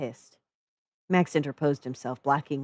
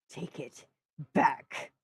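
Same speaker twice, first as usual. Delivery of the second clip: growled; much slower than usual. Acted. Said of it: "I growled".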